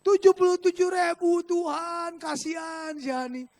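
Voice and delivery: high mock cry, fading in volume; hammed-up pleading, starting loud; shouted plea to God. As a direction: re-enact a wailing plea that dies down. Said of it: "Saya teriak loh".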